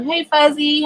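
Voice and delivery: normal talking voice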